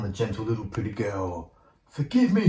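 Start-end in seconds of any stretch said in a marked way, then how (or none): 1.9-2.5 much higher, louder pleading huntsman; begging. Acted. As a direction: beg desperately for forgiveness. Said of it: "he begged".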